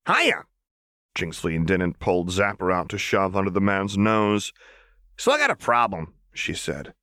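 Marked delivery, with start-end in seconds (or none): 0.1-0.4 high, bright sing-song hello; chirpy Jinx greeting. A narrator's reading. Jinx bursts in on hiding man. 5.2-6.1 put-on a touch higher, playful girl voice; hint of manic Jinx; lightly voiced Jinx. A narrator's reading.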